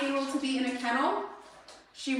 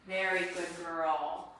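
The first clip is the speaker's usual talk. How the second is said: soft, slow, lower praise; calm the dog with soft praise; soothing praise; 5 semitones lower, 4 dB quieter, slower than usual. Real, not acted. Calming dog in crate.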